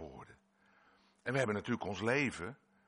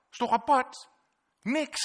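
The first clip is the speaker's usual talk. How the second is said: amazed; 11 dB louder than usual. Acted. Exclaimed wonder.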